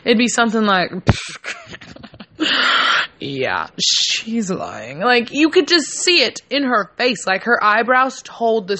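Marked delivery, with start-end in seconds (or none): 2.0-3.2 airy voiceless snicker; a silent, knowing laugh through the nose; the face that gives the lie away; breathy giveaway laugh. Retold or imitated. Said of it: "noises to describe the way her face looked". 3.2-5.0 low, very slow, knowing drawl; the sceptical look, put into words; voicing a giveaway face. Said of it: "noises to describe the way her face looked".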